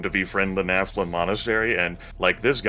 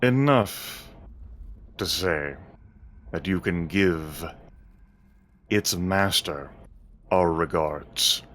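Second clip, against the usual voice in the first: slow, smooth dragon speech; slower than usual. Acted. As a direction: draw it out like a calm dragon. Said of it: "Smooth green dragon voice".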